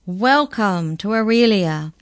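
loud, slow, ceremonious greeting; grand welcome. A narrator's reading. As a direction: welcome them with a flourish. Incisor welcomes them with flourish.

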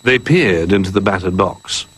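usual speaking voice

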